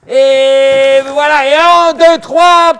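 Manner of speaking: loud, high count ending in a shouted paf. Acted. Knight counting down to trap the dragon, loud high shout. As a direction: slam the book shut with a yell.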